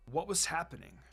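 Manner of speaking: plain reading voice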